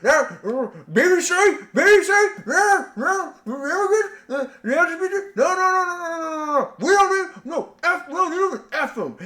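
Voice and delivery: high squeaky mock voice, giggling; hammed-up high-pitched mock panic; mocking quote. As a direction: squeal it like a panicky caricature, half laughing. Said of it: "The alt-right's like".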